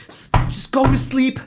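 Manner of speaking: high, strained mock scolding; half-asleep irritation, acted out; mean when tired. Retelling himself telling a flapping kid to sleep, mock cranky. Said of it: "I'm mean".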